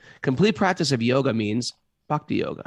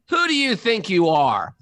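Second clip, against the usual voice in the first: louder, higher mock outrage; indignant retort; 7 semitones higher, 5 dB louder than usual. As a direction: snap it back, offended.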